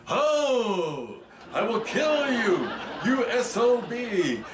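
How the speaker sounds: high shrieking threat, slowed right down. Retold or imitated. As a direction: shriek it way up high and drag out every word. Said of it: "and screamed".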